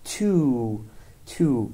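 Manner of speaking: TOO with a long, stretched OO; drawn-out vowel. Shown as a demonstration. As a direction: hold the OO in TOO, slowly modelling it. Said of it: "تلفظشون باید کشیده بشه". (تلفظ کشیده TOO با «او»ی بلند)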